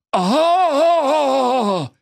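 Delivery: long, high-pitched cry of alarm; crowd's outcry. Acted. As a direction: hold a high scream as the horrified crowd. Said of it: "ging ein Aufschrei durch die Menge". (langer, hoher Aufschrei)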